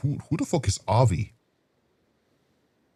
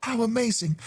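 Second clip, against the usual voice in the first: awed; 12 semitones higher, 4 dB quieter than usual. Acted. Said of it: "I just can't stop saying it".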